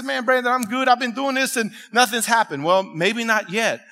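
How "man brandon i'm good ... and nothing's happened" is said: lightly voiced listener, pitched up; higher, running on without a pause; excusing church member. A congregant making excuses to the pastor, excusing himself.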